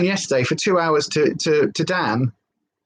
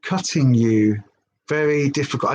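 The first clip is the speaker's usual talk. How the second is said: puzzled slow reread; 4 semitones lower than usual. Shown as a demonstration. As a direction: lower and slow, word by word. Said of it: "I don't know what that means".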